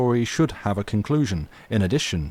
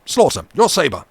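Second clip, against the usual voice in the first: much higher and louder, demanding the sword; furious command; 10 semitones higher, 10 dB louder than usual. Acted. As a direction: call for the sabre in a rage. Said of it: "exclaimed the captain furiously".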